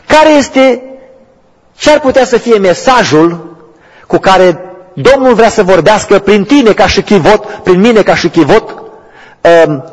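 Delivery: high, loud pleading list of the suffering; impassioned preaching. Real, not acted. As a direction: cry out to the whole church. Preaching at full force. (predică înflăcărată, strigată)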